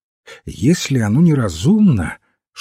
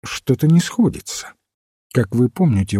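usual speaking voice

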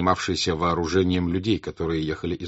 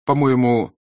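starting to shout; 5 semitones higher, 7 dB louder than usual. Acted. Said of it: "вдруг закричала почти Наташа".

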